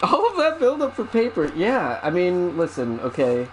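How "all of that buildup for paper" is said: exasperated disbelief. Real, not acted. Mock dismay at the reveal.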